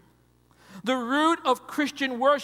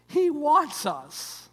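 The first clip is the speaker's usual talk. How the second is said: slow and much higher; high, drawn-out pulpit appeal; rising proclamation; 5 semitones higher, much slower than usual. Acted. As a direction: lift the line like a cry. Repeat rises in pitch.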